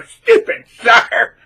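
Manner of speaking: loud, high, drawn-out jeer; exaggerated taunting shout; mock gloating. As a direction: crow it slowly, like a cartoon villain. Acts gloating after the wife's arrest.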